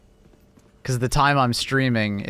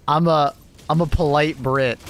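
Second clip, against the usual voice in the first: usual speaking voice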